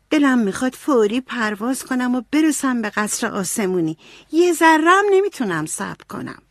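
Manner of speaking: impatient, high-pitched yearning; eager longing. Acted. Beth longs to fly to heaven.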